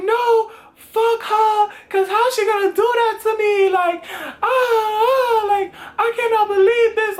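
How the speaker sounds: high-pitched hammed-up wailing; acted-out sobbing protest, drawn out; mock crying tantrum. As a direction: wail it like a drunken meltdown. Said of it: "So I'm crying, I'm crying".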